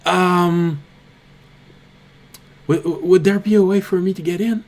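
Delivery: a small creature anxiously begging passage; nervous kobold. Acted. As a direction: rush the request out with nervous stutters. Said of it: "looks nervously around him and says".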